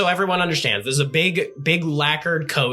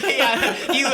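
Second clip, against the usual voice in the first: usual speaking voice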